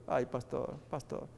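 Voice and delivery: soft, tearful lament, fading away; a quiet grieving cry to the pastor; weeping widow. Retold or imitated. Widow crying to the pastor, softly tearful. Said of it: "la mamá se pone a llorar más todavía".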